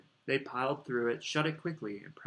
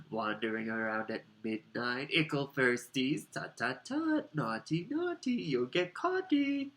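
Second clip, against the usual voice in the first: teasing poltergeist; 3 semitones higher, slower than usual. Acted. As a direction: taunt them like a naughty spirit. Peeves teasing the students.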